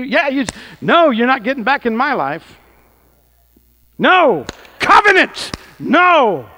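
loud, high commanding no; mock rebuke. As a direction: drive it off like a dog. Rebuking spirits, acted.

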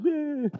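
high, quivery "eh"; mock quaking-in-boots noise; frightened whimper. Preacher recalling shaking in his boots, high nervous whimper. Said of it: "shaking in my boots".

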